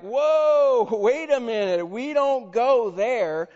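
mock alarm at going there; shocked refusal. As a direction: disciples balking at Samaria, loud and laughing. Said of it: "and they're like".

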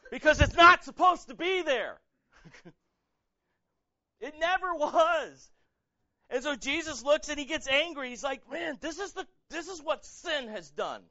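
high, loud preacher's outcry; impassioned preaching. Acted. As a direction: cry it out to the congregation, stretching key words. Preaches with full force.

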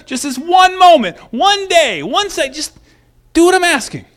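a furious ruler barely keeping patience; angry king. Retold or imitated. King giving the three men a second chance, angry and exasperated. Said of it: "He was angry".

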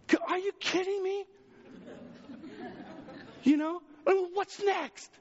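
high incredulous outburst; reliving a bad Monday, high and exasperated. Pastor reliving his bad Monday, high exasperation. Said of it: "it seemed like".

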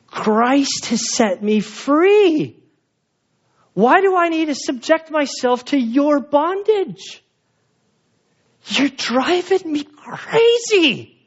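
hammed-up, high-pitched, drawn-out indignation; exasperated protest. Acted protest of encroached-upon person.

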